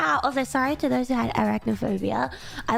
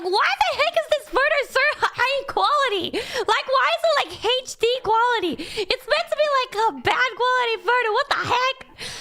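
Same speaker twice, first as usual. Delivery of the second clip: shocked; 9 semitones higher, 3 dB louder than usual. Retold or imitated. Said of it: "I was just like".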